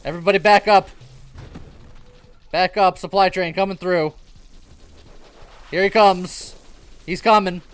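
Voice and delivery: loud, high urgent warning; urgent call. Real, not acted. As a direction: warn everyone to clear the way. Warning about supply train.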